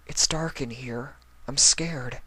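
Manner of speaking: whispered, frightened small voice; scared whisper. Acted. Spike telling Rarity his fear, whispered. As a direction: whisper your fear into the dark. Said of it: "he said in her mind".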